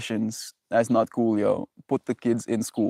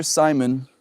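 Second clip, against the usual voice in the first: ordinary talk, nothing marked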